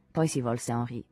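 usual speaking voice

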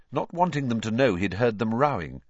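plain reading voice

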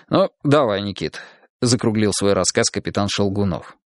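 usual speaking voice

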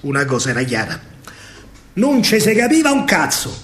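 ordinary talk, nothing marked

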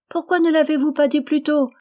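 put-on high, loud little-girl voice; gleeful small girl; joyous child. A narrator's reading. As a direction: exclaim in childish relief. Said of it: "fit-elle avec un rire joyeux".

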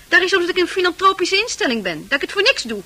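usual speaking voice

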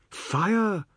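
high urgent cry; boy crying the alarm; cried out. A narrator's reading. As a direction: yell the alarm. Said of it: "Anand cried".

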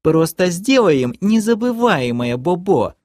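put-on high baby-talk wheedle; pouting girlfriend. A narrator's reading. Girlfriend urging the knight to hurt them, high pouting coo. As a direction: lift it into a sulky coo.

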